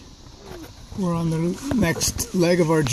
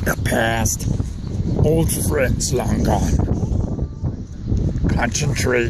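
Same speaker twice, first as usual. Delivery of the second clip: low, slow ancient sage; wise old master voice; 4 semitones lower, slower than usual. Acted. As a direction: drag it out like a wise old hermit. Dad plays wise old master.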